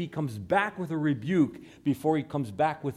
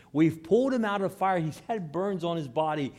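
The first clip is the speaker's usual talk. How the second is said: normal talking voice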